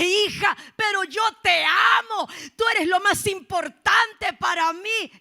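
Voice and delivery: high, loud pleading cry. Acted. Mother pleading with her daughter after court, loud and high.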